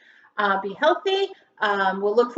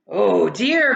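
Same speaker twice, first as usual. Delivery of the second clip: a mild, hammed-up dismayed cry, a touch louder; 6 dB louder than usual. Farmer McNugget frets as the children cry, mildly dismayed. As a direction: fret aloud like a startled old farmer. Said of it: "cried McNugget".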